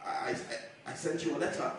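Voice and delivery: barely audible, hushed and scared; an intimidated son-in-law pleading softly; timid whisper. Retold or imitated. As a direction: shrink away as you say it. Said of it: "I was like".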